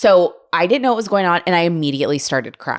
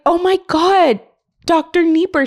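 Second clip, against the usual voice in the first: high, loud delighted surprise; light up, she knows this doctor; surprised recognition; 11 semitones higher, 3 dB louder than usual. Retold or imitated. Patient recognizes her former doctor, high delighted surprise.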